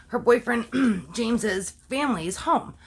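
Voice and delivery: ordinary talk, nothing marked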